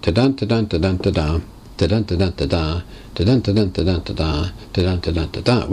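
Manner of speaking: metrical nonsense syllables in a steady beat; unstressed-stressed ta-dun chant; rhythmic ta-dun beat. Shown as a demonstration. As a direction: tap out a poem's meter with ta-duns. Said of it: "We don't get that kind of, uh, rhythm".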